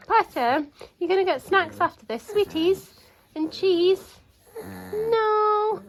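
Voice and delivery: high, loud sing-song pet talk. Real, not acted. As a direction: coo at the dog to win her over. Cooing at dog during bath.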